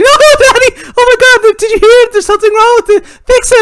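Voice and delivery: put-on very high, loud wailing kid; panicking daughter. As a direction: shriek at Daddy to fix it. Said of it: "she's like".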